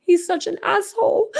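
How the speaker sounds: words pushed out through real tears; real crying. Real, not acted. Streamer crying on a live over the waived trial, tearful. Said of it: "I just cried on a live".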